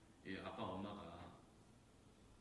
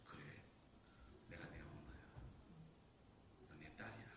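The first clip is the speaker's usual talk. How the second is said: tender whisper; 5 semitones lower, 10 dB quieter than usual. Acted. Mother embraces crying child.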